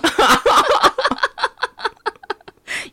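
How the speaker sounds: high rising laughter that falls away; burst of laughter. Real, not acted. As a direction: laugh out loud. Laughing at teasing.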